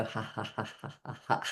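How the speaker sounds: low, hushed breathy ha ha ha; soft laugh in bed. Shown as a demonstration. Lying warm in bed, a soft hushed laugh. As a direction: laugh softly under the covers. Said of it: "you can just go".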